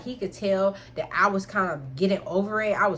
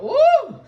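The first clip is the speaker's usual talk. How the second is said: pained ooh; 11 dB louder than usual. Retold or imitated. Pain while walking after.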